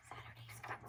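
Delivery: breathy whisper; whispered aside. A narrator's reading. Narrator whispers to the listening kids. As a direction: whisper it like a secret.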